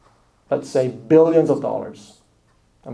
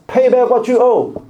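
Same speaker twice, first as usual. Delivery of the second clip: demand; 8 semitones higher, 5 dB louder than usual. Acted. Servant choking fellow servant.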